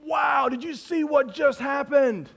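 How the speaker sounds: high, drawn-out amazed exclamation; blown away. Acted. Disciples react to the storm stopping, blown away. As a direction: act out wide-eyed amazement, pitch lifted. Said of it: "The guys are blown away".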